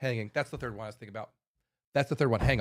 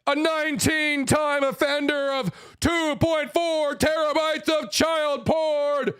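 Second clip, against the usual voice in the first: UFC announcer; 6 semitones higher, much slower than usual. Acted. Introducing the condemned man to the crowd, booming UFC ring announcer. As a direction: stretch every word like a ring announcer hyping the main event. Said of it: "let it be U F C style".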